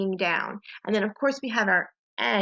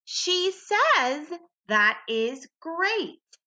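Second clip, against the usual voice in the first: higher, slow, cheerful model read; model sentence to echo; 7 semitones higher, much slower than usual. Shown as a demonstration. Teacher models the new sentence for the class to repeat, excited. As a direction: lead it out slowly for the echo. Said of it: "Be my echo".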